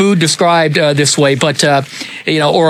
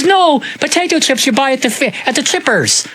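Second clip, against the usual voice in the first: higher Irish voice correcting him; Irish cousin; 9 semitones higher than usual. Retold or imitated. Cousin explaining chips, Irish accent. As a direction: lift the pitch, Irish lilt. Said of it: "He says".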